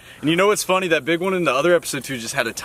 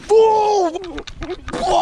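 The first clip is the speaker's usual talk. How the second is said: a huge high whoop, laughing, much louder; real excitement bursting out with a laugh; freaking out; 4 dB louder than usual. Real, not acted. Angler yelling as a big bass jumps off, freaking out. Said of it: "sorry guys for freaking out".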